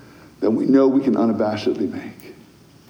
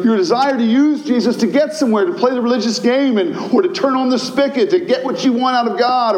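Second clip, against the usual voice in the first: preaching at full force; 9 semitones higher than usual. Acted. Pastor to the congregation, preaching at full force. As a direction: thunder it out with conviction.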